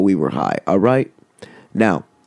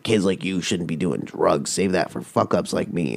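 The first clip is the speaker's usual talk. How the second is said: low, hushed impression of a street guy; lightly voiced older-to-kid scolding, kept quiet; mock advice from a self-declared screw-up; crackhead homeless man; 4 semitones lower, 6 dB quieter than usual. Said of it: "crackhead homeless dude comes up to my buddy".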